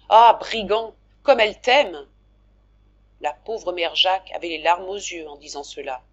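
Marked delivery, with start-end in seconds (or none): 0.1-2.1 loud, delighted teasing cry; excited teasing. Acted. Jacques teasing his brother about the girl, loud and delighted. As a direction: tease him warmly, bursting out loud.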